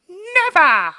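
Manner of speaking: huge high-pitched mock shout of "Never!"; weird line reading. Podcaster exaggerating an actor's weird reading, high mock shout. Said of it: "It's such a weird reading".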